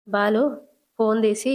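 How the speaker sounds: usual speaking voice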